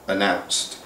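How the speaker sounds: plain reading voice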